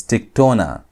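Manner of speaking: tiktona, landing firmly on "tona"; stress on TONA. Shown as a demonstration. Teacher showing where the accent falls, stress on "tona" in tiktona. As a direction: put the emphasis on "tona" in tiktona. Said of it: "I'm putting the emphasis on tona".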